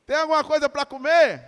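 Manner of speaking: loud calling question; Jesus calling out. Acted. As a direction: call out loud and high across the water. Jesus calls to the disciples.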